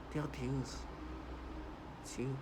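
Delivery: a hint of a fake soothing woman; mock soft voice. Mocking the woman's fake calm for viewers. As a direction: mimic her put-on gentle tone. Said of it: "trying to sound like this".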